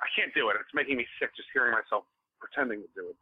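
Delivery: ordinary talk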